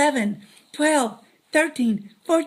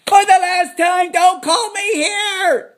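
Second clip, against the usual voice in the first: for the last time, loud, higher, slow and stretched; exasperated shout; 5 semitones higher, 8 dB louder, much slower than usual. Acted. Fed-up host shouting at the caller, very slow and loud. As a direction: bellow it at the end of your patience.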